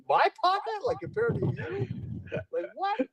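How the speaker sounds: high-pitched mock indignation; mock disbelief. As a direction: squeak up in exaggerated disbelief. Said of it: "I'm like".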